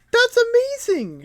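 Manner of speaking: very high, loud drawn-out squeal; hammed-up high-pitched amazement; amazed outcry. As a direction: gush in a shrieking put-on voice. Acts out an amazed character.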